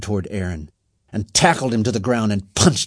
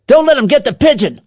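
high, loud urgent yell; urgent shouted command; called order; 13 semitones higher, 10 dB louder, faster than usual. A narrator's reading. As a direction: shout an alarm to the gang. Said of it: "called Carl".

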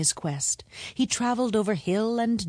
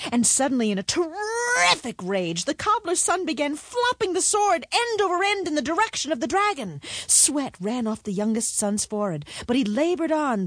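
usual speaking voice

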